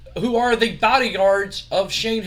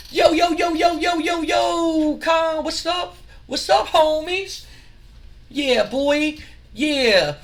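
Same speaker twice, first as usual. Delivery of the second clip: hype street entrance; 10 semitones higher, slower than usual. Retold or imitated. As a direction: call out like a swaggering entrance. Too Cool arrive hyping.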